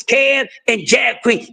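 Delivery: ordinary talk, nothing marked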